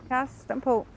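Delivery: high call to a dog pulling ahead. Real, not acted. Walking dogs, calling to them.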